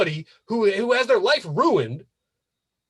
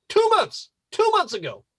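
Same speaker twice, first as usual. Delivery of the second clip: incredulous; 10 semitones higher than usual. Real, not acted. Repeating the time in disbelief.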